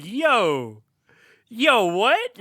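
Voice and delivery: loud, high disbelief; hammed-up astonished double take; acted-out shock. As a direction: replay a stunned film-watcher's outburst. Re-enacts own shocked reaction to film.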